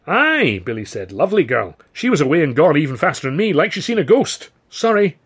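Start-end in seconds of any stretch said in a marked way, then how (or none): 0.1-0.6 high, loud "Aye"; cheery northern mate; northern old pal. A narrator's reading. Billy's character voice. 1.1-5.2 higher, louder cheery mate; chatty northern pal; old pal voice. A narrator's reading. Billy's character voice.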